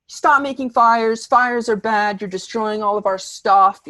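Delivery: high, loud, insistent mock rant; hammed-up ranting complaint; going on and on. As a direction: imitate the friend nagging relentlessly. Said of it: "she just keeps going on and on".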